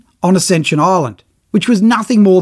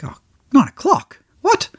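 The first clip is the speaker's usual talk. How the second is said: incredulous "nine o'clock?" with falling stunned tone; disbelieving; 9 semitones higher than usual. Retold or imitated. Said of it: "I'm like".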